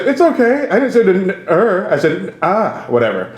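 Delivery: high-pitched mock drunk excusing himself; trashed club owner. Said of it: "he's just trashed".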